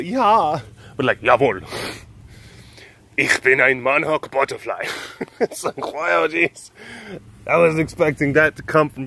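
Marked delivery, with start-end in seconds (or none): 0.0-0.6 high German-accented "Yeah"; German accent, pitched up. Shown as a demonstration. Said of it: "German accent, not like the, kind of the". 1.2-4.9 strong, stout German butterfly; firm mock-German declaration; stout German accent. Shown as a demonstration. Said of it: "stout and strong, like, German accent".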